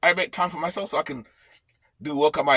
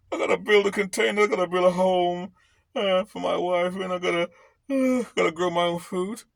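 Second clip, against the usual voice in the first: mock whining.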